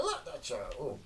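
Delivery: delighted laugh. Real, not acted. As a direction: chuckle it out. After the shot, laughing.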